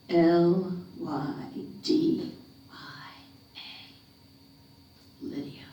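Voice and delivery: fading to a whisper on the name; hushed reveal. A narrator's reading. Narrator revealing the name on the grave, fading to a whisper. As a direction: let the name fall away to a whisper.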